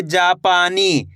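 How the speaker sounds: जापानी with stretched long vowels; drawn-out reading. Shown as a demonstration. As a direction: hold each syllable of जापानी. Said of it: "खींच के पढ़ा जाएगा ये".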